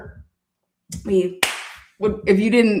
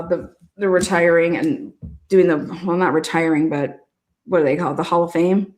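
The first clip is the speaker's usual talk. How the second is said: ordinary delivery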